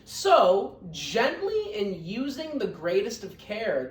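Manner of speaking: lively, sing-song storybook reading; reading to children. A narrator's reading. Teacher reading Horton Hears a Who to her scholars, lively storybook voice. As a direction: read it out warmly to a class of kids.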